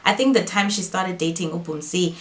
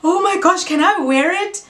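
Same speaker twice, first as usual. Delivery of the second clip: high, louder, gushing; excited squeal; 11 semitones higher, 5 dB louder than usual. Retold or imitated. Younger sister begging to wear the ring, thrilled. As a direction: beg to try it on. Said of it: "I was excited".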